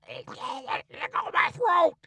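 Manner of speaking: high, slow strangled shout; hoarse shouted plea to be let go; choking shout. A narrator's reading. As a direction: yell it while being throttled. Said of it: "The king sings out".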